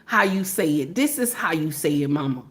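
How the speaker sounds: low, quiet mock-patient little one; baby correcting mama. Mother imitating her baby correcting her, low and soft. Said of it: "even the baby, the baby get in there".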